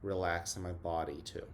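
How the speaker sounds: soft, unhurried breathing guide; guided meditation. Acted. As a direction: ease the class into calm, breath by breath. Leads a mindful minute.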